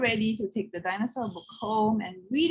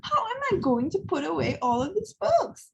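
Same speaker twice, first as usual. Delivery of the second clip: dismayed volunteer; 9 semitones higher than usual. A narrator's reading. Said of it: "moaned the volunteer".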